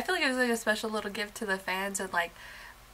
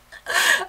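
a high, loud laugh, caught off guard; burst of laughter; 13 semitones higher, 7 dB louder than usual. Real, not acted. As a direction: laugh out, overwhelmed by the song. Overwhelmed fan laughs.

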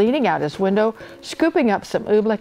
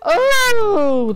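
high, loud drawn-out "oh"; dismayed wail; 13 semitones higher, 6 dB louder than usual. A narrator's reading. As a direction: wail in distress. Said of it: "The bell ringer wrung his hands".

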